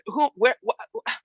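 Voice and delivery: high, halting panicked stammer; retold panic. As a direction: stumble over the words in alarm. Panic at losing niece.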